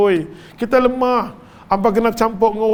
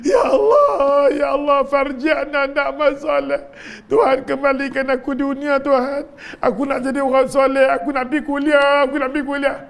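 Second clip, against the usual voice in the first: desperate pleading; 8 semitones higher than usual. Acted. The dying sinner begging God to send him back, wailing. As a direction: cry out to God begging for another chance. Dying man begs to return.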